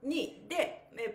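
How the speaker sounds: ordinary talk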